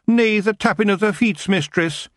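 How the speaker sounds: high rough Scottish pixie; broad Scottish wee man; Scottish accent. Acted. Feagle Rob pleads.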